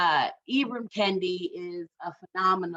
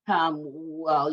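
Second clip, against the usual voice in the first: ordinary delivery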